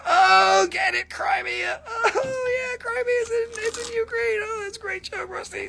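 self-mocking squeaky aside; mocking falsetto. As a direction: sneer at your own joke in a silly high voice. Mocks his own Crimea pun.

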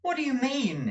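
sharply high, alarmed question; startled exclamation. Acted. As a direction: demand it, suddenly alarmed. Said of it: "exclaimed Nicholas".

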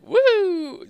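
loud falsetto whoop; high celebratory woo. Real, not acted. Player celebrating his call, high whoop.

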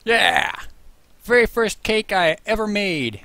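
loud, high cheer of triumph; excited cheer. Real, not acted. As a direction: celebrate the first cake out loud. Cheering over first cake.

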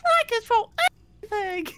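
high squeaky villain imitation; mock creepy voice. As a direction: parody the sinister taunt, hammed-up. Mocking the creepy taunt.

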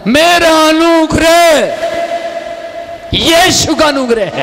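a risen man shouting his faith; triumphant proclamation. Retold or imitated. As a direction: proclaim it in victory. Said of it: "उठकर बोलता है".

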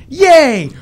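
put-on high, loud childish yay; loud kid cheer. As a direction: yell it like a thrilled little boy.

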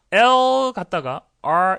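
loud, long-held L, then R; L moving to R. Shown as a demonstration. Teacher demonstrates going from L to R, slow and exaggerated. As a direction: stretch "L" out slowly before gliding into "R". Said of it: "이렇게 가야죠".

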